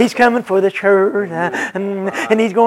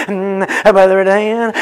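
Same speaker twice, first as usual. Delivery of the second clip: ordinary talk, nothing marked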